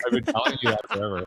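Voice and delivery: mock exasperation. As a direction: play it up like you've said it a hundred times. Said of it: "I'm like".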